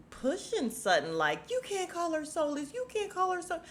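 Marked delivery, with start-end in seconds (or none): 1.5-3.6 high put-on voice mocking Kyle. Vlogger mocks Kyle defending Sutton, high squeaky voice.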